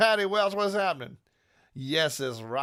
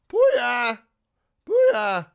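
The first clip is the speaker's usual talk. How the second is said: loud, high booyah shouts; hype shout; 10 semitones higher, 13 dB louder than usual. Real, not acted. Performer shouting booyah at the crowd, loud and high.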